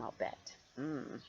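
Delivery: plain reading voice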